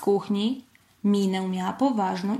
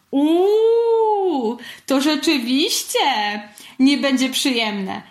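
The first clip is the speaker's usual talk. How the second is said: long, high, loud "uuu" of distaste; disgusted; 6 semitones higher, 5 dB louder, slower than usual. A narrator's reading. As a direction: recoil from a nasty smell. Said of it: "skrzywił się Pacyniusz". (z obrzydzeniem, krzywiąc się)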